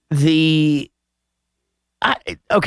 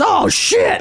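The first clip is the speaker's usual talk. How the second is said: imitating a sudden yelp, much higher; startled yelp. Retelling a movie guy's startled cry, mock yelp. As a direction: squeak out the surprise. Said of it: "just because he goes".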